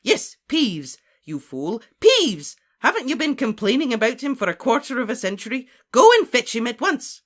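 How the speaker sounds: high, loud reprimand; impatient, commanding outburst; exasperated scolding. Acted. Professor scolds baffled caretaker.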